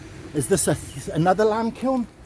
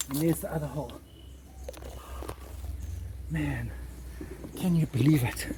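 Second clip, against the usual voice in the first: softer, hushed so the neighbours won't hear; 3 semitones lower, 9 dB quieter than usual. Real, not acted. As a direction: keep it down, sneaking past a house. Near someone's house, trespassing worry.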